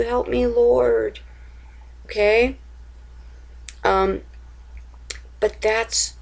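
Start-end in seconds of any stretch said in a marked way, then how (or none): 0.0-1.2 slow, higher plea; slow, earnest plea to the Lord; humble plea. Shown as a demonstration. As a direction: pray it slowly, humbly. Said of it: "that's what you do".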